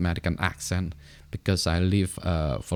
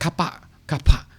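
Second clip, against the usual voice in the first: higher, louder Singaporean "Kapa, kapa" for car park, the r and k swallowed; imitating Singaporean English; 8 semitones higher, 5 dB louder than usual. An airport worker pointing a lost traveller to the car park, in Singaporean English. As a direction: mimic the airport worker saying "Kapa, kapa" clipped to two syllables. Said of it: "the person said".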